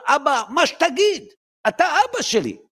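put-on high, slow childlike devotion; child's voice. A child tells his father whatever he says goes, high and slow. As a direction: say it up high like an obedient little boy.

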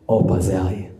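ordinary delivery

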